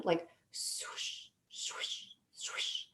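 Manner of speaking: breathy whispered swoosh of a heartbeat. Retold or imitated. Woman imitating her heartbeat for the podcast host, whispered. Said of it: "It was like a swooshing sound".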